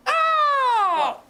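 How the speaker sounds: high-pitched, drawn-out gushing; delighted squeal. Real, not acted. Gushing over the cutest thing ever, squealing. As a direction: squeal it out, stretching the words in delight.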